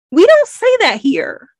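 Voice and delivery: high, slow, drawn-out objection; mock indignation. American reader objecting to "cuppa", mock indignant. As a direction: protest it slowly with pitch way up.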